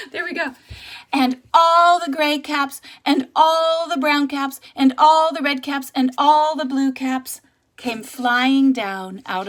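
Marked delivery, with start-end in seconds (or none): 1.1-9.5 louder, higher, slow and grand; big excited storybook climax; excited buildup. A narrator's reading. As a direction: make the caps rain down. Caps flying down, climax.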